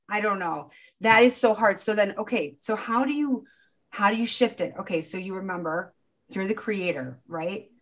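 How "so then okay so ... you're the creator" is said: ordinary delivery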